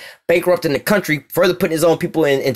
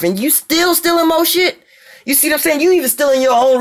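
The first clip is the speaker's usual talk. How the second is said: outraged disbelief; 9 semitones higher than usual. Real, not acted. Outraged rant at America.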